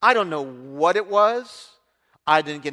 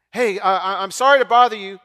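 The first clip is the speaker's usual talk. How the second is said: apologetic neighbor; 2 semitones higher, 7 dB louder than usual. Retold or imitated.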